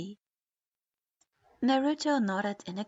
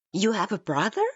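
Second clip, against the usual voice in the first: louder disbelieving shout; excited yell; 7 dB louder than usual. A narrator's reading. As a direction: shout in amazement. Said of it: "tired of the excited yelling".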